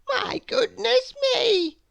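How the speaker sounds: very high, drawn-out wail; farmer's wife wailing. Acted. Farmer's wife amid the pandemonium, wailing. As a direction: wail it slowly, high and comic. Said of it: "wailed the farmer's wife".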